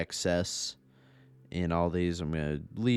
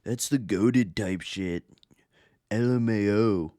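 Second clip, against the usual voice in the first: hammed-up slang delivery, a bit higher and louder; mock Gen Z voice; 3 semitones higher, 4 dB louder than usual. Shown as a demonstration. Mocking his own slang after noticing it, hammed-up Gen Z voice. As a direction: poke fun at how Gen Z talk sounds. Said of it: "such a fucking Gen Z thing to say".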